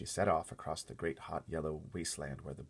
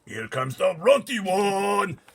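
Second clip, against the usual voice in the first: huge, slow, much louder and higher monster boom; booming giant; 14 semitones higher, 16 dB louder, much slower than usual. Acted. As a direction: boom it across the plain. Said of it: "boomed the flesh lump eater".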